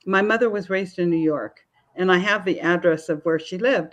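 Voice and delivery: ordinary talk, nothing marked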